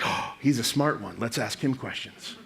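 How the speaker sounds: low, soft, awestruck aside; hushed admiration. Acted. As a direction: confide it with mock reverence. Onlookers admire the greybeard.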